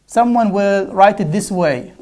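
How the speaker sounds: ordinary talk, nothing marked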